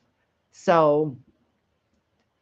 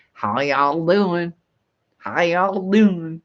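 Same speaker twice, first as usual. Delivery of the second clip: slow, stretched-out Southern drawl; 5 dB louder, much slower than usual. Retold or imitated. Reading out a Southerner's greeting to the viewers, heavy Southern drawl. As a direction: draw the vowels right out in a Southern accent. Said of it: "they do have that drawl".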